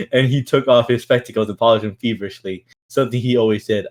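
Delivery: usual speaking voice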